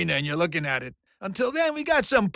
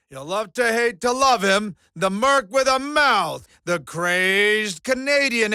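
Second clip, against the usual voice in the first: ring announcer; 3 semitones higher than usual. Acted. Showman hypes his fighter to the crowd, ring-announcer bombast. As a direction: stretch the name out like a fight announcer.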